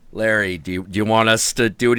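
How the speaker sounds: low, gruff mock metalhead; metalhead dudes. Metalheads offering the father to handle the boy, low and gruff. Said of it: "metalhead dudes going like".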